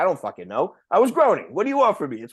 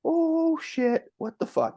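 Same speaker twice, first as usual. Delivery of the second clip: startled; 14 semitones higher, slower than usual. Retold or imitated. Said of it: "and you're like".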